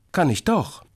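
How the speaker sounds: put-on high, childish comeback; defiant. A narrator's reading. Little brother contradicts his sister, defiant. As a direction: insist like a stubborn little kid. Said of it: "stampft trotzig mit dem Fuß auf".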